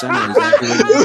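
high, fast, loud laughing outburst; laughing squeal. Real, not acted. [name] laughs at the hole-in-the-wall claim, high fast laughing squeal.

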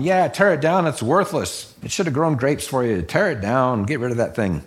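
mock angry crowd call; crowd urging. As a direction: egg him on like a worked-up crowd. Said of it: "And they're going".

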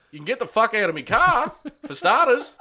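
high, loud outraged car owner; mock angry yell. Car owner ordering the driver out, angry yell. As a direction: yell it in indignation, acting out the story.

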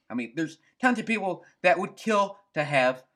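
ordinary talk

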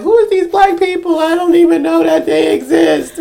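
high-voiced bewildered dog, lightly put on; imagined dog's thoughts.

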